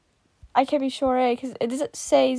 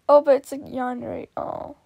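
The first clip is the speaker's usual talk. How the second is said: ordinary talk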